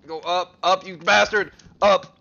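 loud, high, furious yells at a creature; angry shouting. Real, not acted. Player yelling at a stuck creature in the game, furious shout. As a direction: bark at it to go up.

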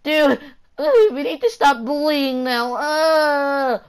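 mock crying shout. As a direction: wail it like a whiny crybaby. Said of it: "he was literally crying".

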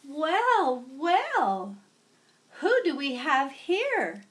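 put-on old teacher welcoming a new student; kindly teacher. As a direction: greet a new pupil warmly. Kindly teacher greets newcomer.

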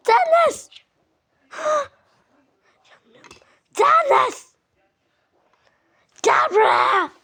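loud, high angry shouting; furious summons. Acted. Calling Deniz over after the mischief, furious shout. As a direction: yell for the culprit to come.

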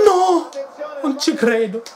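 high, stunned disbelief; can't believe what you're seeing; disbelieving yelp. Real, not acted. Shock as Gonzales goes forward. (incredulo e acuto)

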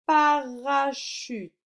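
high, loud, slow syllables; slow dictation. Shown as a demonstration. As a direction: spell out the syllables by ear, slowly. Teacher dictating words for sound exercise. (dictée lente, syllabe par syllabe)

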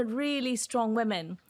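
normal talking voice

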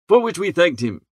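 usual speaking voice

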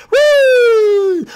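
long, loud, very high "woo"; high-pitched cheering shriek; excited whoop. Acted. Cheering at playing superheroes.

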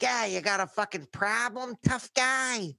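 high-pitched tough-guy challenge; combative, pitch thrown way up; imitated belligerent yell. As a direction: mimic an aggressive driver hollering out the window. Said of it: "you're yelling at me like that".

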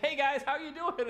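high, loud cheery hey guys; pumped greeting. Pretend. Risen Jesus popping in on friends, high and loud. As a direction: burst in bright and pumped. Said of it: "he's like".

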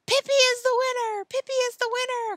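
very high, loud chant of victory; cried cheer. A narrator's reading. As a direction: proclaim the champion to the whole tent.

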